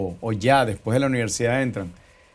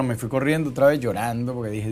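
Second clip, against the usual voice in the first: ordinary delivery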